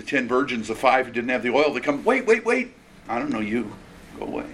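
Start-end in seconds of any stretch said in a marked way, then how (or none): 2.0-2.7 higher-pitched, urgent acted plea. Virgins without oil begging at the door, urgent plea. Acting latecomers at closing door. 3.1-4.5 low, understated rejection; quiet dismissal. Acted. Master to latecomers at shut door, quiet dismissal.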